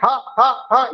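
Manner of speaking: ह, ह, ह pushed out with a puff of warm air; repeated aspirated ह demo for the class; breathy h sound. Shown as a demonstration. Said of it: "ये जो ह जो निकलता है".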